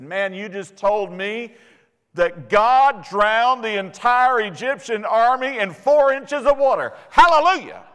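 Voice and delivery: building from plain to a high, loud hallelujah; triumphant praise. Retold or imitated. The student answers the professor, building to a loud high hallelujah. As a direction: climb to a jubilant shout of praise.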